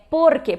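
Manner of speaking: "porque" as POR-ke; stress on first syllable. Shown as a demonstration. Teacher restates the correct word, "porque" stressed on POR. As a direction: lean on POR in "porque", light final ke. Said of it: "porque é uma palavra só".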